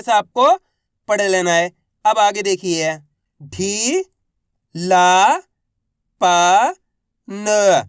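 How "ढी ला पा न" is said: ढी, ला, पा, न each alone and held; letter-by-letter drill. Shown as a demonstration. Teacher for students to repeat, ढी, ला, पा, न drawn out singly. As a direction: sound out ढी, ला, पा rising, न falling. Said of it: "एक अक्षर का उच्चारण".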